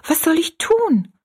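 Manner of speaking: high-pitched, helpless cry; utterly dismayed. A narrator's reading. Rosalie begs Betty Ann for advice, utterly dismayed. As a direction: ask in distress, voice leaping up. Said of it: "rief Rosalie ganz bestürzt aus".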